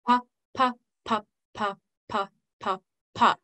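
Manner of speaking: "pa" twice as often, evenly tapped; quick off-beat rhythm. Shown as a demonstration. Teacher going back over the pattern, quick off beats. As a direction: double the beat on "pa", stepping down in pitch. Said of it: "our quick one having voice on the off beats".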